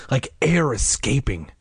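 usual speaking voice

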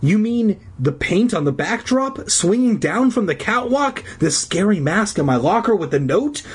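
high, incredulous questioning; astonished. Acted. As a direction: list it in rising amazement. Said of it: "I cried in astonishment".

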